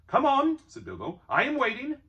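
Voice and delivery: a hint of a bold hobbit; bold and cheerful. A narrator's reading. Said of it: "He tried to sound bold and cheerful".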